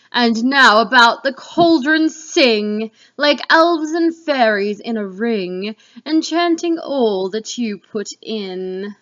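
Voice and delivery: loud, slow, commanding goddess voice; Hecate. Acted. Hecate praising the witches, loud and slow. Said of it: "This picture will represent Hecate".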